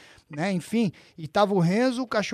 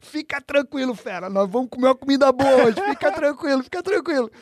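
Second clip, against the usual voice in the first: reassuring Renzo; 5 semitones higher than usual. Retold or imitated.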